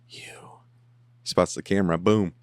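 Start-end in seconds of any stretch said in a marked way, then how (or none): 0.1-0.6 breathy whispered "You."; whispered reply to the therapist. Retold or imitated. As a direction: drop to a hushed whisper. Quoting Dexter's reply.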